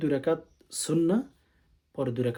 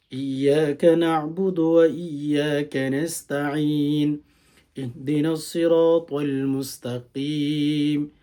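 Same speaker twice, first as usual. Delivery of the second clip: slow, louder, melodic tilawat; Quranic recitation; 5 dB louder, much slower than usual. Shown as a demonstration. Imam teaching the Fajr prayer, chanting Fatiha slowly. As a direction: chant the Fatiha with long held vowels.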